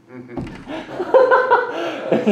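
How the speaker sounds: loud, high real laugh; high burst of laughter. Real, not acted. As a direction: crack up at your own joke. Laughs at his own line.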